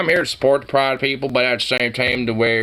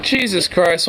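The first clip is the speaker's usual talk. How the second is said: normal talking voice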